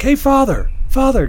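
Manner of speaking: high, loud call from the street. Retold or imitated. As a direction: imitate someone hollering for a priest. Homeless man calls through street window.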